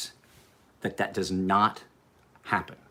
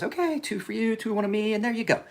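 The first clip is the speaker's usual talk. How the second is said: high-pitched, sing-out helper voice; imitated park employee; 13 semitones higher than usual. Park employee taking pictures for a parent and kid, high put-on voice. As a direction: put on a high voice for the helpful photographer.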